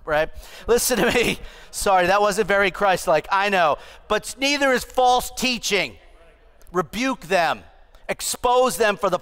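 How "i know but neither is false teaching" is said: ordinary delivery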